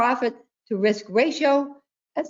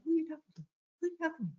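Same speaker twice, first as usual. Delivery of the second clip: retold hushed, higher voice asking who's on the phone; hushed question; 8 semitones higher, 12 dB quieter, much faster than usual. Wife hushedly asking husband who he's talking to, higher, softer. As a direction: quietly mimic her own startled question. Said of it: "And I'm saying".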